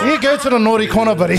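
high, loud scolding parent; mock stern dad scolding. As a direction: send the kid to the corner. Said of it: "I'm just like".

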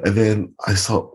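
ordinary talk, nothing marked

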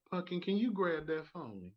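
mock stranger calling you pumpkin; sweet Southern stranger. A Southern stranger asking a favour sweetly. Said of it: "people that don't even know you".

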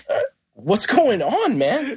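high, drawn-out retold challenge; slow, high-pitched mock alarm; mock confrontational greeting. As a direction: imitate an agitated guy demanding answers.

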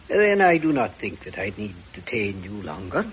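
ordinary delivery

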